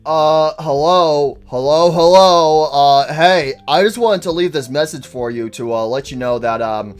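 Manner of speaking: low, hesitant message voice; halting, matter-of-fact caller; phone guy. Acted. Phone guy leaving recorded message.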